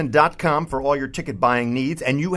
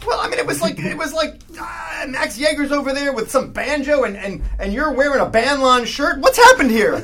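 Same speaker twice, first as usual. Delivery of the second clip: much higher, raised indignant rant; indignant yelling; 9 semitones higher, 4 dB louder than usual. Acted. Host scolding his replacements, a higher indignant rant. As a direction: rant at them in disbelief.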